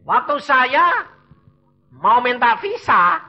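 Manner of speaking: slow, high, loud proclamation; emphatic announcement. Real, not acted. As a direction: proclaim it slowly to the congregation. Preacher launches next episode loudly. (berseru lantang dan lambat)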